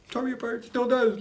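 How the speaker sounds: the voice climbs as he recalls the book; emotional, voice rising high. Real, not acted. Talking about a book that moved him, voice lifting high. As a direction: pitch lifts with the feeling.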